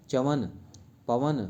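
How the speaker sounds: ordinary talk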